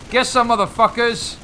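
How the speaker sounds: loud, high taunting yell; battle taunt yell. Real, not acted. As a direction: roar it at the enemies. Firing at enemies in game.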